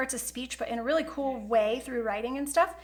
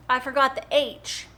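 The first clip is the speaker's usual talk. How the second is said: normal talking voice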